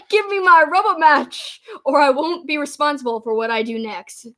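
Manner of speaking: mock British heel threat; British accent. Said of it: "I can't do a, a awesome British accent".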